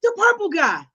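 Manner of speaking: high, emphatic grieving grandma; grandmother's voice. Retold or imitated. Grandmother breaking the news, imitated distress. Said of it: "but do the voices".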